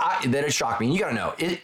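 usual speaking voice

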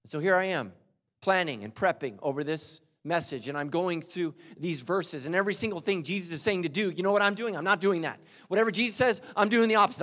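loud, high, fervent preaching; full-force preaching. Acted. Pastor urges the congregation to seek God, loud and high.

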